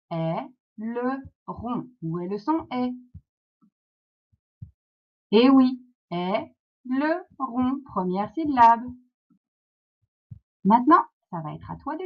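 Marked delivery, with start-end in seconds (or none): 0.1-1.9 "Aileron" slowly in three beats, ai... le... ron; cut into syllables. Shown as a demonstration. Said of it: "quand on le découpe, ça fait". 6.1-7.8 "aileron" drawn out in three beats, ai... le... ron; cut into syllables. Shown as a demonstration. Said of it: "quand on le découpe, ça fait".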